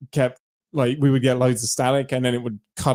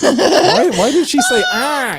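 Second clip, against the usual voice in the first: squeaky laughing question; high laughing voice; 14 semitones higher, 5 dB louder than usual. Acted. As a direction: ask it through giggles, high up. Laughing imitation of reaction.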